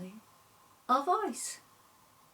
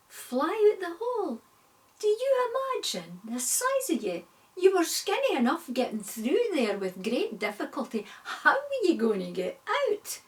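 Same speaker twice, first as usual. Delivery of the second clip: much higher, louder mocking disbelief; incredulous spider; 8 semitones higher, 4 dB louder than usual. Spider mocking the fly's plan, incredulous high scoff. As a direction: scoff at the fat fly.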